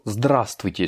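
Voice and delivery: "здравствуйте" with a long, punched ЗДРА; stress on first A. Shown as a demonstration. Teacher pointing out the accented vowel, stress on ЗДРА in "здравствуйте". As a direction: lean slowly on the first "а" of "здравствуйте". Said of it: "sur le A".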